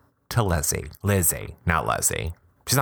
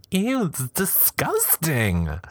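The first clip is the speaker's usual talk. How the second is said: high, slow disgusted groan; 9 semitones higher, much slower than usual. Real, not acted. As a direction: drag it out, grossed out. Reacting to two days' clothes.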